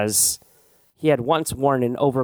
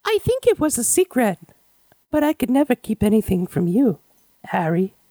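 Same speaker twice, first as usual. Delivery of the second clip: much higher put-on voice, teasing Harry; gushing admirer voice; 11 semitones higher than usual. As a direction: swoon over Harry in a high made-up voice. Ad lib character gushing at Harry.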